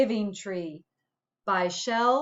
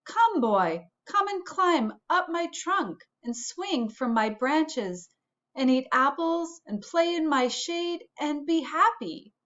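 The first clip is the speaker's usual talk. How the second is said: higher, lightly voiced tender tree; a hint of a kind tree calling the boy; loving tree; 6 semitones higher than usual. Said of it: "and the tree said".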